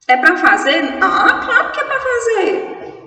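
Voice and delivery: playful high-pitched "of course" reply to a silly question; mock question and answer. Teacher answers students asking whether to do the exercise, playfully mocking.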